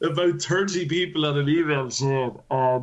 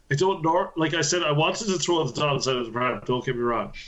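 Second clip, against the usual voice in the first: usual speaking voice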